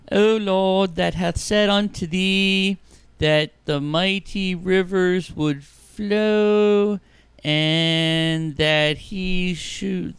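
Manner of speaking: slow, loud, solemn prayer voice; mock biblical prophet. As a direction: intone it slowly like a pulpit prophet. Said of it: "Try it".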